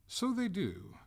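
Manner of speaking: high, loud concession; lilting, emphatic agreement; airy concession. Acted. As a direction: concede it brightly before the catch. Master gleefully concedes the point.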